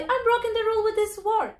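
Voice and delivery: high, playful announcement; cheerful mock announcement to everyone; announcing to everybody. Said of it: "we tell to everybody".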